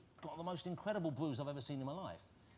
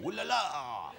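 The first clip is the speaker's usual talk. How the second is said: mock French; 6 dB louder, much slower than usual. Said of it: "full-on kind of comedy cinematic".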